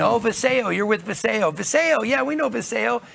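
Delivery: a thrilled mock greeting, voice lifted up; excited recognition. Locals recognising the Viseo shirts.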